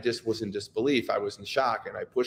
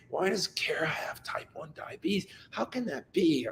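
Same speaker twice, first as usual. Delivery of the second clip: whispered, bitter and a bit higher; angry whisper; 3 semitones higher, 7 dB quieter than usual. Retold or imitated. Father asking why his daughter is ill, angry whisper. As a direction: hiss the question under your breath in disbelief. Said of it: "then we get angry".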